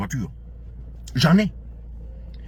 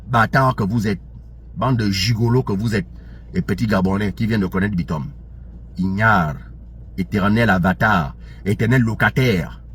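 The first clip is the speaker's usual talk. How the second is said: much lower, contemptuous voice; scornful insults; 9 semitones lower than usual. Real, not acted. Angry man insulting his online detractors, low and scornful. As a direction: spit the insults out low and heavy.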